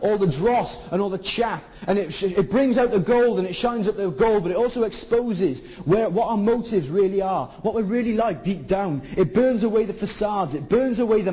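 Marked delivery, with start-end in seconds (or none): none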